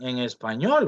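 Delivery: ordinary delivery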